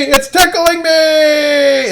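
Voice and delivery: "It's tickling me" drawn out slow, high and loud; slow tickled whine. Acted. Daniel among licking lions, "It's tickling me" slow, high and loud. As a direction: stretch "It's tickling me" into a long squeal.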